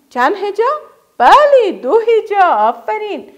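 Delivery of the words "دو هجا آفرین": ordinary talk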